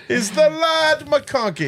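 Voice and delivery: high, slow mock brogue, Scottish gone Irish; Irish-sounding Scottish accent. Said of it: "It does sound more Irish".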